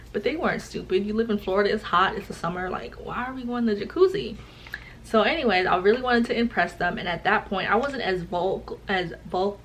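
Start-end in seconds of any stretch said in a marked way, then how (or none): none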